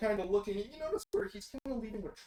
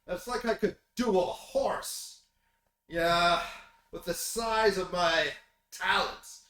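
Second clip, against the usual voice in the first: loud, higher, gladhanding showman; boastful pundit; 5 semitones higher, 6 dB louder than usual. Acted. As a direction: ham up the smug self-praise loudly. GM voices Tucker Carlson as NPC.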